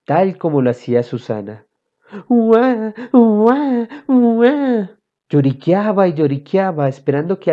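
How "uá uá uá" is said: high imitated baby wail; put-on "waah" crying, high and drawn; mock newborn crying; fake baby whimper. A narrator's reading. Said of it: "comencé a lloriquear en la cama".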